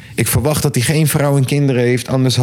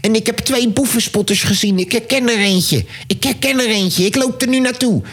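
high, excited field-report imitation; Boevenspotter; 7 semitones higher than usual. Thief spotter reporting from the Volendam fair, high excited impression. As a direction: mimic an eager amateur sleuth closing in, pitched up. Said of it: "De boevenspotter".

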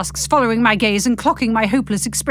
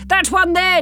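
high eager call; excited cry; 8 semitones higher than usual. Acted. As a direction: cry out pointing. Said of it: "Derek cries".

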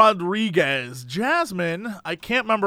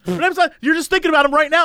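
raised, fast, exasperated protest; mock exasperated yell; 9 semitones higher, 3 dB louder, faster than usual. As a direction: blurt it out, fed up and higher. Angry boyfriend corrects drunk girlfriend.

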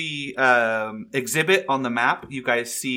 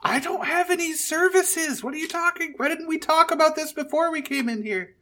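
much higher, fast flustered protest; high panicked voice; faster than usual. Acted. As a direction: squeak it out in a panic. Sal panics at being volunteered.

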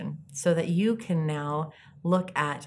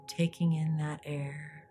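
soft spa whisper; 7 dB quieter than usual. Acted. Leads a guided meditation.

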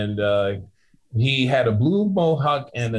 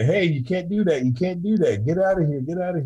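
stern bartender; 7 semitones higher than usual. Retold or imitated. Bartender throwing him out.

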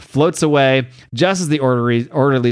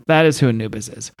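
normal talking voice

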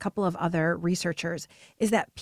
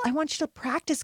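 normal talking voice

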